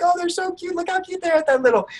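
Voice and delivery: very high-pitched mock cooing; cooing over cuteness. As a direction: coo at something tiny and adorable. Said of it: "because you're like".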